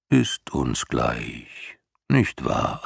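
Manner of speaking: put-on slow, low blowing old beast; gurgling creature voice. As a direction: let it gurgle out slowly like a huge old turtle. Morla indifferent.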